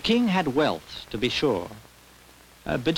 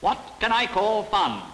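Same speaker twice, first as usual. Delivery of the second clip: higher, insistent royal question; lightly voiced king pressing for an answer; demanding king; 8 semitones higher than usual. A narrator's reading. King questions the little old man, demanding.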